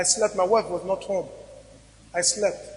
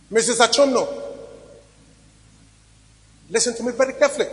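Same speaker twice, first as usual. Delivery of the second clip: preaching. Real, not acted. Pastor exhorting his congregation about God and children, fervent preaching. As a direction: preach it, hammering each point home.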